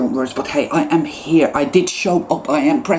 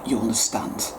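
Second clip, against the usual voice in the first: much softer, sinking low; softly driving the point home, hushed; 5 semitones lower, 9 dB quieter than usual. Real, not acted. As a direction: let You understand fall to a near whisper. Repeated, fading to a whisper.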